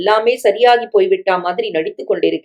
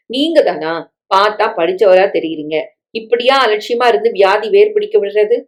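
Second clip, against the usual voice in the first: ordinary delivery, nothing marked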